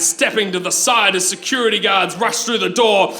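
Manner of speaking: normal talking voice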